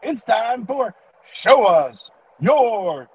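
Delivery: booming high game show host; announcer voice. Pretend. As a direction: hype it like a ringmaster. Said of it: "I'd let you show us the right way to".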